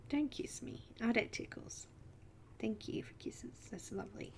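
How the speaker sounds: soft unvoiced whisper to the cat. Real, not acted. Whispering to the cat nuzzling her face, real hushed whisper. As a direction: hush it right down, barely breathing the words.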